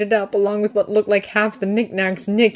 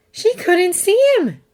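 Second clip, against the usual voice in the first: slow, high, aghast fairy voice; horrified; 12 semitones higher, 4 dB louder than usual. Acted. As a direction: finish the thought in dawning horror. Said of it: "Tooth finished horrified".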